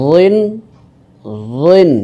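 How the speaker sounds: রিন as heavy "dhwin"; full emphatic dh in রিন; thick heavy DH. Shown as a demonstration. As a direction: thicken the voiced th starting রিন. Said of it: "মোটা করে".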